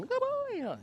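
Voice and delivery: put-on very high, sing-song good boy; praise tone. Shown as a demonstration. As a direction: show the dog your praise tone, up high. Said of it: "my tone".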